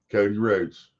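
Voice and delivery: ordinary delivery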